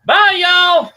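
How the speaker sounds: high sing-song farewell; cheery high goodbye. Real, not acted. Sign-off goodbye.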